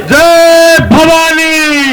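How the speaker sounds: very slow stretched war cry; drawn-out slogan. Real, not acted. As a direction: hold every vowel of the cry. Slogan called to crowd. (खिंचा हुआ नारा)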